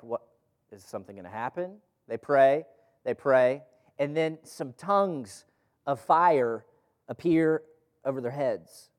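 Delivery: ordinary delivery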